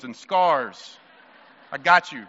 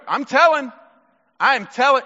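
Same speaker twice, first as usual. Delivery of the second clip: high, loud sing-song tattle; taunting like a little sibling; tattling kid; 8 semitones higher, 8 dB louder than usual. Retold or imitated. Preacher mimics a younger sibling threatening to tell, loud and high.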